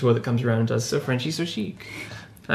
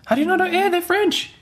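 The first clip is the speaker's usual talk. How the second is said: high-pitched teasing disbelief; aghast and teasing; mock incredulity. Real, not acted. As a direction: exclaim in playful outrage, pitch way up. Mock outrage at friend's ignorance.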